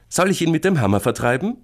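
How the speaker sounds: high, loud brisk offer; bold big sister. Acted. Sister offers to chase creature off, bold. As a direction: propose it boldly in a girl's voice.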